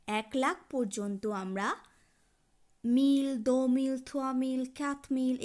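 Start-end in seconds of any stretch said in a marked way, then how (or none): 2.8-5.4 each "মিল" projected firmly for learners; French pronunciation demo. Shown as a demonstration. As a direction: recite "মিল, দো মিল" loud and higher, like a counting drill. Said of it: "এভাবে স মিল পর্যন্ত উচ্চারণ".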